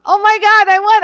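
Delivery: high, loud mock squeal of a winner; host voicing Gail's joy at winning; excited winner. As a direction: play the thrilled winner.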